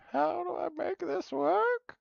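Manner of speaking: put-on high, slow old man voice. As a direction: play a fussy old man asking for help. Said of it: "my old, old man voice".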